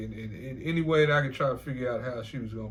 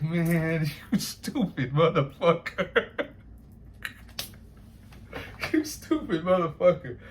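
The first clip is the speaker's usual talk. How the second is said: loud, drawn-out words breaking into laughter; laughing insult; 3 dB louder, slower than usual. Retold or imitated. Reacting to the replayed boast, loud laughing mockery. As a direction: laugh helplessly through the retold insult.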